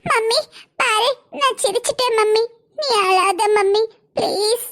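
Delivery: put-on high, slow little kid coaxing; child voice. Little son begs mother to stop crying, high slow child voice. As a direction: comfort mommy like a small child.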